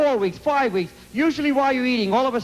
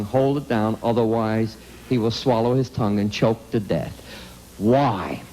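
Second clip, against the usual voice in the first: grave storytelling; 9 semitones lower than usual. Acted. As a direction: lower voice, heavy-hearted report. Grave low slow update on nephew.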